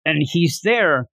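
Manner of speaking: ordinary talk, nothing marked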